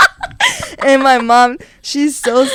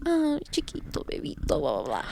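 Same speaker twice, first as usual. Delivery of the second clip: put-on mom babying son; 3 semitones lower, 11 dB quieter than usual. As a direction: coo quietly like a doting mom. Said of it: "he acts like a baby".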